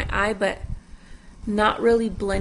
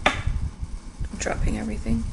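whisper. Real, not acted. Muttered aside while fumbling.

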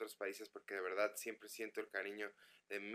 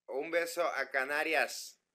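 mock Castilian accent, slow and louder; Spanish accent; 4 semitones higher, 7 dB louder, slower than usual. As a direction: greet the Canaries with a put-on Spanish accent. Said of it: "¿Qué tal el acento español". (acento español de imitación, lento y fuerte)

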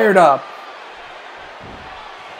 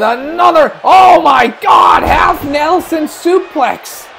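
amazed play-by-play; 4 semitones higher than usual. Real, not acted. Commentator amazed by a half Nelson suplex, excited outburst. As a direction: burst out in amazement and stretch the call.

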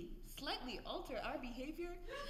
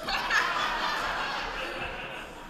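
loud breathy villain cackle; gloating laugh; 8 semitones higher, 12 dB louder than usual. Acted. King mocking the crowd, gloating cackle. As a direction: cackle at the losers.

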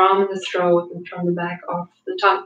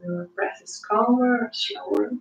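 guided meditation; 4 dB quieter than usual. Acted. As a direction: talk them gently into calm. Guiding a calm breathing meditation.

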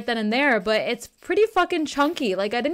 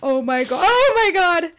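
rising panicked cry, higher and louder; panicked yell; 5 semitones higher, 6 dB louder than usual. Real, not acted. Streamer cries out as the beast returns, frightened.

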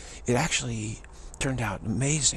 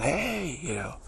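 mock yelling; 7 semitones higher, slower than usual. Audience heckling the singer on stage, mock yelling. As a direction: imitate a crowd calling out, pitched well up. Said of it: "people started standing up and yelling".

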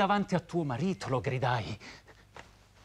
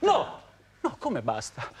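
desperate refusal. Acted. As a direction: burst out against being dismissed. Lover refusing to be dismissed.

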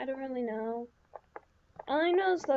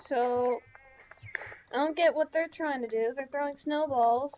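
ordinary delivery

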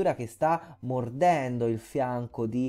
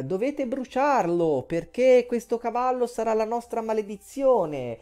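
urlo riportato; 7 semitones higher than usual. Retold or imitated. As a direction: call out a warning in a raised pitch, half-hearted shout. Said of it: "E quindi urla e dice". (grido d'avvertimento solo accennato, su tono alto)

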